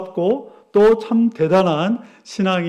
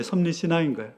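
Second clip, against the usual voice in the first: usual speaking voice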